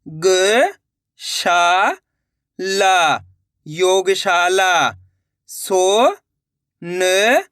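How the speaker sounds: each syllable said alone with long pauses. Shown as a demonstration. Teacher sounding out syllables for pupils. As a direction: break the word into its sounds slowly. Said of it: "teacher sounding out words".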